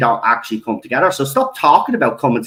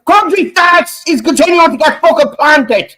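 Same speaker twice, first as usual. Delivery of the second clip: angry outburst; 14 semitones higher, 6 dB louder than usual. Real, not acted. Ranting climax of angry vlog.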